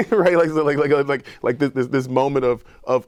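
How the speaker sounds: bursting into loud, high laughter mid-sentence; laughing. Real, not acted. Laughing about nobody sharing the elevator with him.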